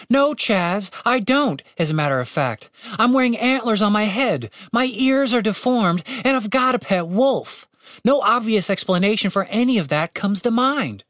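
high, exasperated, indignant tirade; shouting back. A narrator's reading. Woman rebutting her companion's "obvious", high indignant outburst. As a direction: list each complaint in a high, agitated voice. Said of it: "Catherine shouted back at him".

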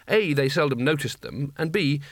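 ordinary delivery

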